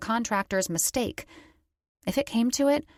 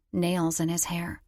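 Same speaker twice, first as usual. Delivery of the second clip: usual speaking voice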